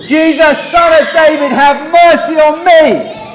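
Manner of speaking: high, loud, slow plea shouted to be heard. Shown as a demonstration. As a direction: cry out for mercy, high and loud. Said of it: "let's try it another time".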